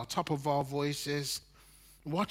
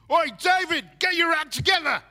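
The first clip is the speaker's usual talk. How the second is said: shouting; 13 semitones higher, 9 dB louder than usual. Pretend. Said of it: "God shouting at us".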